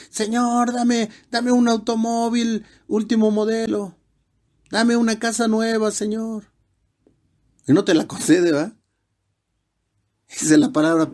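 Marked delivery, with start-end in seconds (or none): 0.1-6.5 high, whiny begging prayer; mock the believer who demands a new car; greedy mock prayer. Preacher mimicking a materialistic believer praying, high and whiny. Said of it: "en lugar de que le digas al Señor".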